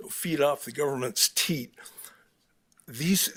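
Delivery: normal talking voice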